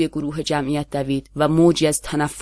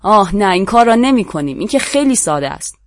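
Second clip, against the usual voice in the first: louder, higher spiteful refusal; loud fiery retort; 5 semitones higher, 6 dB louder than usual. A narrator's reading. A voice from the crowd answers the prisoner, loud and fiery. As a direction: fling the refusal back with venom. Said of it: "صدایی بلند و شرربار برخاست".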